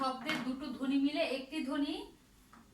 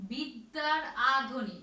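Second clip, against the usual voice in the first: আ in বিদ্যার drawn out and punched louder; slow, louder আ in বিদ্যার for the class; stress on আ; 5 dB louder, slower than usual. Shown as a demonstration. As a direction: lean slowly on the আ sound in বিদ্যার. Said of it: "মনোযোগ সহকারে শুনবে".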